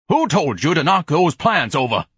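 loud, high reprimand; angry scolding. Acted. Ignatius to whoever knocked the bean plants over, scolding. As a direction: demand an answer loudly.

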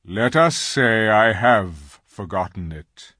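slow, grave lion voice. Acted. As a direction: say it gravely, like a king. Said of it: "answered Aslan gravely".

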